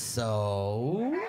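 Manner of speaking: put-on high, drawn-out girlfriend gushing; gossipy girlfriend. Husband playing his wife's girlfriend, high and gushing. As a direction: gush like her best girlfriend wanting details.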